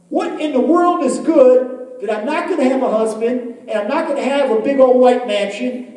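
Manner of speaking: loud, high indignant tirade; angry. Retold or imitated. Jilted barmaid arguing with God over chapter two, angry. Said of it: "And she was angry".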